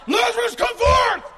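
very high, loud retold yell; imitated yell. Preacher imitates his friend's prank on a tour, loud yell. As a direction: yell it like a tour guide at the cave. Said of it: "he yelled".

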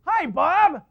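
imitated child, high exuberant shout; boy's loud greeting. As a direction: belt it out like an eager little kid.

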